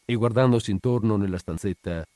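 ordinary delivery, nothing marked

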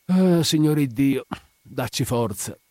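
drawn-out, sighing invocation; weary plea stretched through a half-yawn. A narrator's reading. Widow stretching before she turns back to Spina, sighing yawn. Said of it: "tra uno sbadiglio e un sospiro balbettò".

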